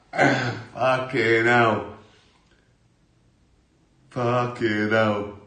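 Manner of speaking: slow, loud laughing curse. Real, not acted. Laughing at his own spider story, slow and loud. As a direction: drag it out through laughter.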